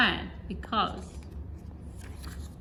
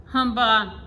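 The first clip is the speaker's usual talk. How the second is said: grumbling groan; 5 dB louder than usual. A narrator's reading. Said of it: "he would groan".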